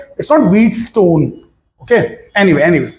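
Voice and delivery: ordinary talk, nothing marked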